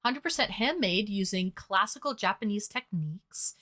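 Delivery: normal talking voice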